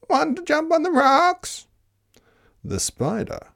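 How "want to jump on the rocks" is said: very high, bleating goat. Acted. Goat inviting the spider to the rocks, high bleat. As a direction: squeal it out like a goat. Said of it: "said the goat".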